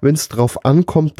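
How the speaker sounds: usual speaking voice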